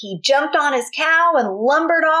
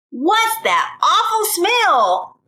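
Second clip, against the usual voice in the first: higher, slow, drawn-out disgust; 8 semitones higher, much slower than usual. Acted. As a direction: wrinkle your nose at the stink. Milton smells Bubba.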